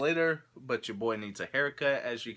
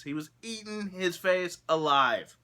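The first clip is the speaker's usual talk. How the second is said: incredulous emphasis; 7 semitones higher, 4 dB louder than usual. Real, not acted. Stresses the shocking news story.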